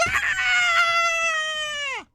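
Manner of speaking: high excited squeal of delight; thrilled shriek. Retold or imitated. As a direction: shriek with joy like a wife hearing big news. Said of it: "all, all thrilly".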